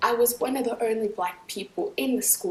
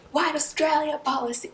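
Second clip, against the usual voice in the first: higher and pushier retold chant; a man's retold slogan, now louder; louder, more aggressive; 6 semitones higher than usual. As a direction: push the slogan up, getting aggressive. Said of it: "it keeps getting louder and, like, more, like, aggressive".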